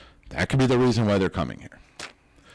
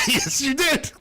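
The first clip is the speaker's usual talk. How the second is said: laughing; slower than usual. Real, not acted. Laughing reply.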